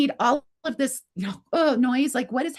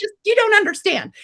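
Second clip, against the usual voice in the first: high, louder mock-distraught plea; retold tearful protest; 9 semitones higher, 5 dB louder than usual. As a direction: protest as if crying, pitched high. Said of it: "I'm, like, crying".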